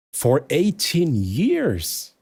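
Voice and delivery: For eighteen years? dragged out slowly in disbelief; astonished. Real, not acted. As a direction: stretch the question out in amazement.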